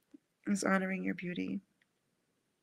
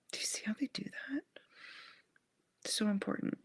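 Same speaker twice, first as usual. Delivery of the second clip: quick whisper; 2 semitones higher, 4 dB quieter, faster than usual. Real, not acted. Excited aside.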